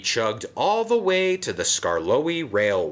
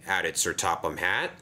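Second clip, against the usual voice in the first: plain reading voice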